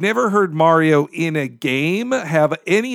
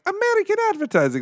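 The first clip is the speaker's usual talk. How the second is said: high, quick mimicry; mock advertising voice; faster than usual. Co-host mimics an American ad, high-pitched mock voice. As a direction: pipe it up high and fast.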